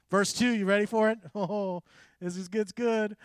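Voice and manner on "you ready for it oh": usual speaking voice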